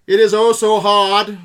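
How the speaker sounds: drawn-out mock newsreel line; old-timey voice. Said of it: "old-timey voice".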